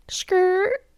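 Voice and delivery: high, quiet skidding sound; imitated tire screech. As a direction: mimic tires squealing to a stop. Imitates car screeching in while pulling in.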